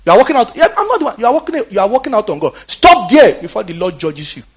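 high, loud, furious rebuke of the leaving crowd; retold angry rebuke. Pastor rebukes the crowd walking out on God, loud and high. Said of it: "He said".

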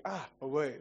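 chuckling mock rebuke; scolding his own evil thoughts, laughing; laughing self-scold. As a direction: tease yourself through laughter. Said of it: "sometimes I like".

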